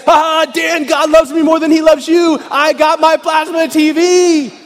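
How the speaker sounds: loud, high, gleeful bragging; gloating brag. Retold or imitated. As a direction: crow over your friend, slowly and triumphantly. Gloating phone call to friend.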